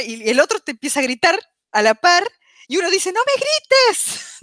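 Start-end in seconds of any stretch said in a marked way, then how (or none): 3.1-4.4 very high, drawn-out mock yell; imitating a shouted complaint. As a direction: stretch it out as a hammed-up squeal of protest. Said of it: "uno está gritando primero".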